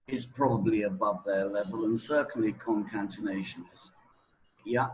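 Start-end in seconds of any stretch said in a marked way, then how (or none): none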